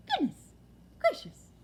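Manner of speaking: put-on high, loud startled old lady; startled cry. Old lady startled by Plop landing, crying out. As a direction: jump at the sudden thump. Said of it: "cried the old lady".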